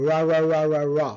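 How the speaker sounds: "ra" repeated with the tongue off the palate; R sound demo. Shown as a demonstration. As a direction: roll out "ra" in a run of untrilled English r's. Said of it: "আর এর জিহ্বা কখনোই তালুতে লাগবে না".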